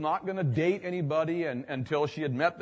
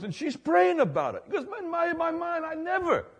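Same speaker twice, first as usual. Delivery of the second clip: much higher, astonished retold voice; amazed man. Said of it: "And he goes".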